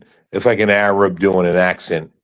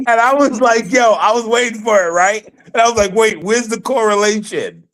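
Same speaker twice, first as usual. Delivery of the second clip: high excited retelling; 12 semitones higher than usual. Real, not acted.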